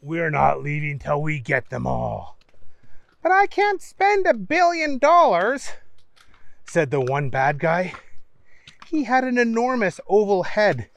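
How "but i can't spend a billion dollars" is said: much higher, squeaky-villain voice; high bad-guy voice. A narrator's reading. Less menacing bad guy to his partners, high comic voice. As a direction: pitch it way up for the silly villain.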